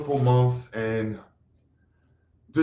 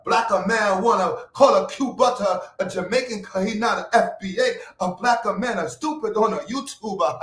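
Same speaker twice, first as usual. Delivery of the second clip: mock Nigerian accent; 9 semitones higher than usual. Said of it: "use my Nigerian accent".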